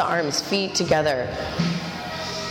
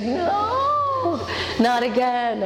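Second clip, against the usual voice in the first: whiny student; 5 semitones higher, much slower than usual. Retold or imitated. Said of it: "She's like".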